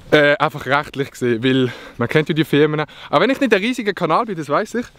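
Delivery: ordinary delivery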